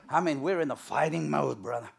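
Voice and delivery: normal talking voice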